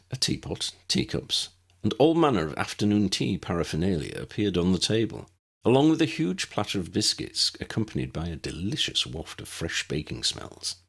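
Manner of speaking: ordinary delivery, nothing marked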